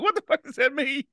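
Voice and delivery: very high, incredulous mock question; imitated bafflement. Recruit puzzled by sergeant's German, high-pitched mock bafflement. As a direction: squeak out a confused recruit's reaction.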